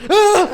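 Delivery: high, loud yelp; sudden piercing mock cry, imitating a seizure. Someone laughs and the comic seizes, mock high yelp. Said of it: "and he goes".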